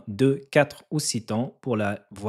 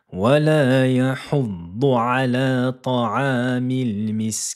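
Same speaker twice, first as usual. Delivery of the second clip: Quran recitation; 3 semitones higher, 5 dB louder than usual. Shown as a demonstration. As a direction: recite the verse in melodic chant.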